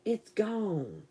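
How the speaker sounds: quietly settling it down; soft hushed repeat. Real, not acted. Telling Christy the bug is gone, softly murmured. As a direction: drop to a near-murmur.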